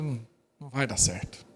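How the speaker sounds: low, soft deadpan "não vai dar certo"; soft deadpan aside; low dry aside. Retold or imitated. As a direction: mutter it like a knowing verdict. Pastor's dry comment.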